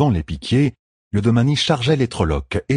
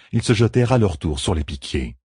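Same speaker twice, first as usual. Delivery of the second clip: plain reading voice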